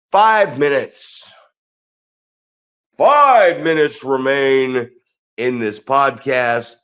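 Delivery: slow, stretched announcer time call; drawn-out mock announcement; ring announcer call.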